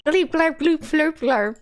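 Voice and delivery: high alien gibberish, dropping at the end; alien language. Acted. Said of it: "It's, like, all in this language".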